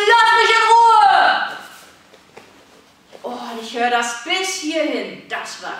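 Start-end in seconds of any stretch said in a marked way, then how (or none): 0.1-1.6 very high, loud, all-out LASS; full-force shout. Shown as a demonstration. Coach giving the refusal with all her strength. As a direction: put all your strength into the yell. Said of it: "alle Kraft zusammennehmen".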